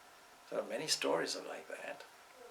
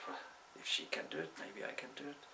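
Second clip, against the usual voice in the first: doubtful self-talk; 2 semitones higher, 8 dB quieter than usual. Acted. Pundit talks himself into trying.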